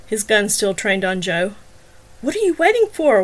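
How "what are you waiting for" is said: high, louder whine; whining. Acted. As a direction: whine it impatiently. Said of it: "Wagner whined".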